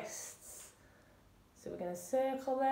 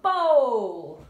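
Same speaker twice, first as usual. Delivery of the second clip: loud, long-held call; bowling call; 9 dB louder than usual. Shown as a demonstration. Coach calling the bowling move, loud and drawn out. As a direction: throw the word out loudly, stretched.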